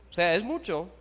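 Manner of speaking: ordinary delivery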